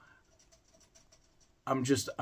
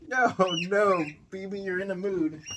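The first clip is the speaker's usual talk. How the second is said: higher and louder quick no, no; scolding no; 10 semitones higher, 5 dB louder than usual. Real, not acted. Vlogger to misbehaving guinea pig, louder higher scolding. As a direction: stop the pet in its tracks, loud and high. Pet misbehaving during recording.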